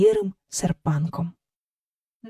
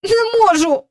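high, out of breath; breathless boy; 9 semitones higher, 4 dB louder than usual. Acted. Michael gives up pulling the kite, breathless and high. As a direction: strain from pulling, short of breath. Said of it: "поки геть не засапався".

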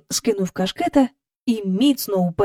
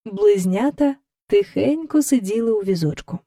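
plain reading voice

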